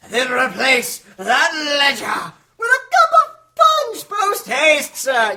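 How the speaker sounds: hearty bellowed order; bellowing order. Acted. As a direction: boom it out like a jolly master.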